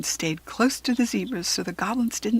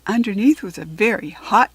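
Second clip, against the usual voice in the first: ordinary delivery, nothing marked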